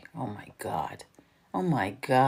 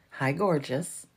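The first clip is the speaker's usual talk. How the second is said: normal talking voice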